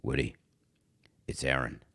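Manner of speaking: hushed, very low phone voice; Aaron Rodgers calling Woody. Pretend. Aaron phoning the team owner, in a hushed low voice. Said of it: "I bet he talks like this".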